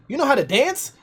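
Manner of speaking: high rising question; lightly imitated, high-pitched query; retold girl's question. Retold girl asks him.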